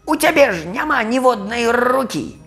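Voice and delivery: high, exasperated squawking; yelling crow. Acted. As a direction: argue frantically in a high crow voice. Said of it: "закрычаў Крумкач". (крыклівы высокі голас крумкача)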